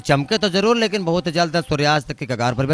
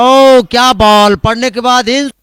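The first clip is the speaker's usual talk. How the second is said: loud, higher shot call; excited cricket commentary; 6 semitones higher, 8 dB louder than usual. Real, not acted. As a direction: shout the big hit.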